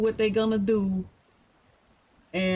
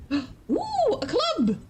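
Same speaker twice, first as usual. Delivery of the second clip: delighted ooh; 11 semitones higher, much slower than usual. Real, not acted. Spots a club emote.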